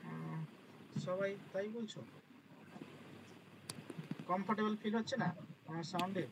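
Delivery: soft, distant line; weak microphone sound; faint call audio. Real, not acted. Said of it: "একটু কম শোনা যাচ্ছে আপনার". (ক্ষীণ শোনা যাওয়া আওয়াজ)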